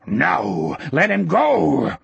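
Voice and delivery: throaty roar, rising and drawn out; strong roar. A narrator's reading. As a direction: roar it from the throat to defend a friend. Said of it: "surprisingly strong roar came from his throat".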